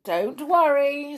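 upbeat storybook comfort; cheerful. A narrator's reading. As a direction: brightly brush the worry away. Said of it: "said Bron cheerfully".

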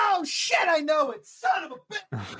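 high-pitched burst of frustrated recognition; excited outburst. Real, not acted. Contestant suddenly recognizing the music clue, excited outburst. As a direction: blurt it out high, kicking yourself. Sudden realization of the quiz answer.

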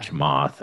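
Chamath said tuh-MAHTH, stress at the end; another pronunciation attempt. Real, not acted. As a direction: say Chamath with an open ah in the last syllable. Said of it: "I'm sorry if I don't say it right".

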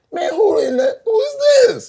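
high, bewildered laughing exclamation; who on earth is this guy; confused surprise. Retold or imitated. Retelling confusion at dreadlocked friend.

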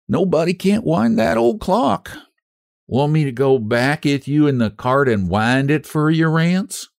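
lightly voiced hopeful wife; pleading wife voice. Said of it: "proof against emotion".

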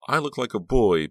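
high, emphatic complaint; insistent. A narrator's reading. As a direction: insist on it, pitch pushed up. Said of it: "Becca insisted".

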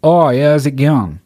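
high, loud mock Australian; Australian accent. Shown as a demonstration. Host showing Australian listeners his bad accent, hammed-up Australian. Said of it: "That's my fucking Australian accent".

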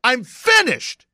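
very high, loud mock-desperate yell; slow, overblown yelling protest; exasperated shout. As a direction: shout that you are through, hammed up. Swears off rhymes after relapsing.

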